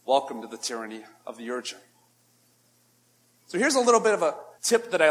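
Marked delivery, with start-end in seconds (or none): none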